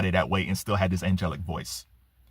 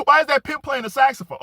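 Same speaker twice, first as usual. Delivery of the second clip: amused onlooker quote; 4 dB louder, faster than usual. Retold or imitated. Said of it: "He was like".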